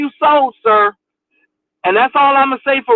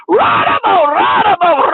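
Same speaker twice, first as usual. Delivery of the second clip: shouted fake tongues, pitched up; mock speaking in tongues; 4 semitones higher, slower than usual. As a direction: ham up a preacher babbling in tongues. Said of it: "speaking in tongues".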